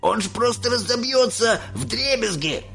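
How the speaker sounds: high, alarmed protest; cried out. A narrator's reading. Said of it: "выкрикнул дедушка Джо". (испуганный высокий выкрик)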